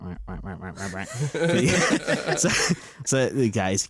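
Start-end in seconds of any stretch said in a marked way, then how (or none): 0.0-1.1 much softer mock quacking; hushed duck noises, repeated; imitating duck quacks. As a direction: quietly mimic ducks in the distance. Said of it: "all you can hear in the background is".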